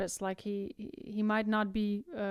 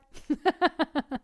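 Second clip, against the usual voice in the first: laughs; 6 semitones higher, 5 dB louder than usual. Real, not acted. Laughs after joke.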